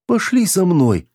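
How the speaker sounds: high, drawn-out entreaty; pleading. A narrator's reading. Girl begs the officer to come along, pleading. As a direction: beg in a slow, high whine. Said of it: "взмолилась Руди".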